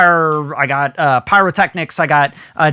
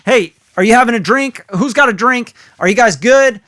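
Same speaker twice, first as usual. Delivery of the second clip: higher, louder party host check-in; hype host banter; 6 semitones higher, 4 dB louder than usual. Retold or imitated. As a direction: get the crowd amped. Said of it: "I try to get people amped up".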